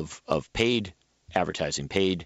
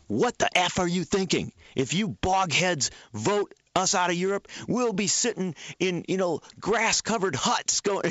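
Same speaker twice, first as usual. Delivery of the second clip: higher-pitched imitation of an exasperated ad woman; mock outburst; 9 semitones higher than usual. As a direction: act out her outraged outburst in a raised pitch. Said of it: "And then she goes".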